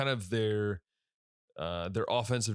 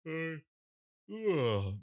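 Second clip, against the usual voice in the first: yawning; 7 semitones higher than usual. Real, not acted. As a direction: yawn stretches the words. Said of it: "Sorry, I'm yawning again".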